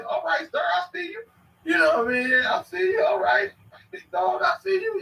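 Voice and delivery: high, excited shout of praise; big up the little kid, way up high; hyped greeting to a boy. Retold or imitated. Hailing a ten-year-old boy, high excited call. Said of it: "I might call a little boy".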